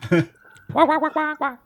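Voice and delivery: high comic wah-wah sting; mock trombone wahs. Game master answering the joke episode title, high trombone wahs. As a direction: imitate a muted trombone after a bad joke.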